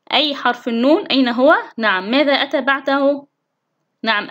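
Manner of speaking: ordinary talk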